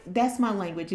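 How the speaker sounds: usual speaking voice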